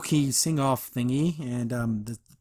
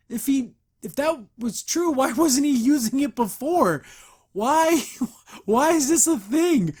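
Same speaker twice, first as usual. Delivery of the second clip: incredulous; 9 semitones higher, 4 dB louder, slower than usual. Real, not acted. Baffled by the plot twist.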